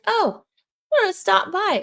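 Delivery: high, lilting mom impression; mom's voice. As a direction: chirp it like a breezy mom. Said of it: "My mom would be like".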